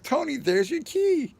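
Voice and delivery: high, excited call to a friend; high-pitched excited call. Retold or imitated. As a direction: announce the find, thrilled. Calling out second key.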